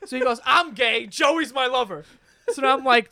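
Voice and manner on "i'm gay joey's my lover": higher mock proclamation, slowing at the end; imitated classroom announcement; said out loud. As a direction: declare it boldly for all to hear. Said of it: "Says this out loud in class".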